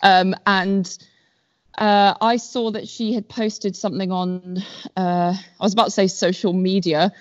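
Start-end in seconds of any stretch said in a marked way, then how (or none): none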